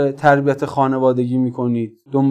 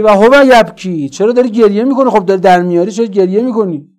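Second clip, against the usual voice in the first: raised, higher-pitched indignant questions; indignant questioning; 6 semitones higher, 5 dB louder than usual. Retold or imitated. Preacher voicing Sakina questioning a weeping looter, indignant and high. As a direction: challenge him in a high, pressing voice.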